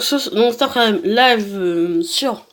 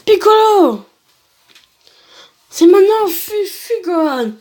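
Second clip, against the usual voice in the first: urgent acted cry; 7 semitones higher, 4 dB louder than usual. Characters call to each other mid-battle, urgent acted cry. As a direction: cry out as if a friend were in danger.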